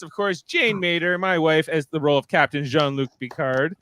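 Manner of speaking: ordinary delivery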